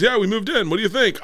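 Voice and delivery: a high, bright imitation of his father; cheery dad. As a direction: play the proud oblivious dad showing off. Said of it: "And he goes".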